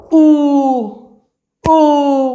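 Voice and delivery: "उ" held long each time; long vowel. Shown as a demonstration. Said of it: "लांब असतो". ("उ"चा लांब उच्चार)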